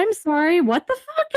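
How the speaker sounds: high-pitched incredulous question; retold disbelief. Student reacting to the degree exchange rate, high retold disbelief. Retelling her shock at good news.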